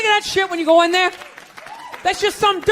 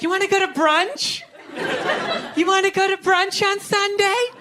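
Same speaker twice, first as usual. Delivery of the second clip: put-on higher singsong girlfriend voice; imitating girlfriend; 5 semitones higher than usual. Girlfriend asking about Sunday brunch, higher singsong. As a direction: lilt it sweetly upward. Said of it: "they'll be like".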